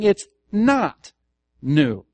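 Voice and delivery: "not" drawn out high and loud, then "new"; stress on NOT. Shown as a demonstration. Pastor tells the church what the writer means, "not" stressed hard. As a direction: stretch and punch "not", set "new" apart. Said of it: "The emphasis is there".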